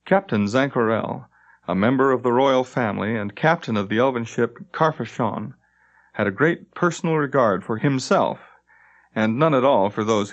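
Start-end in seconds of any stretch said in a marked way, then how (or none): none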